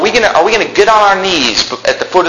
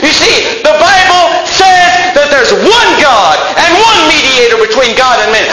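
fervent preaching shout; 8 semitones higher than usual. Real, not acted. Preacher at full force.

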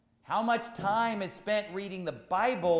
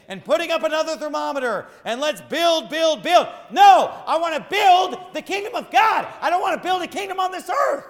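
rising preaching shout; 11 semitones higher, 12 dB louder than usual. Real, not acted.

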